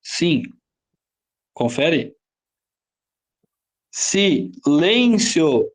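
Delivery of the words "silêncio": "Silêncio" slowly split as Si - LÊN-cio; slow teacher's chunking of "Silêncio"; syllable by syllable. Shown as a demonstration. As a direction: sound out "Silêncio", weight on LÊN. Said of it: "é a penúltima?".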